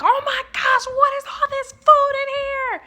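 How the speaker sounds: cartoonish gasping outrage; mock shocked dad. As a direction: play the dad flipping out, exaggerated. Dad discovering fridge full of dishes.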